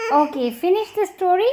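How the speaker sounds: high, fast coaxing; eager coaxing. Real, not acted. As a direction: hurry them along brightly. Urging child to finish.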